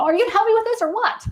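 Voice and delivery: put-on high, fast whiny kid voice; frustrated kid. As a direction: play the fed-up child asking for help.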